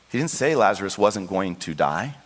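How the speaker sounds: ordinary talk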